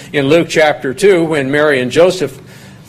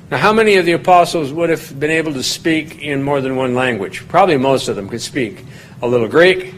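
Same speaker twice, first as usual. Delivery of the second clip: usual speaking voice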